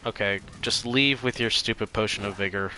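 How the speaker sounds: ordinary talk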